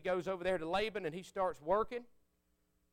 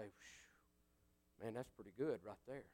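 hushed awe; 9 semitones lower, 14 dB quieter, slower than usual. Retold or imitated. Driver savouring gospel song alone.

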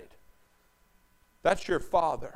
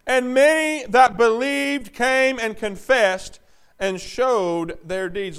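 slow, raised, drawn-out preaching; emphatic scripture reading; 7 semitones higher, 9 dB louder, slower than usual. Acted. Preacher reading on about those who believed, slow and emphatic. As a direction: stretch the verse out forcefully. Reads scripture at full force.

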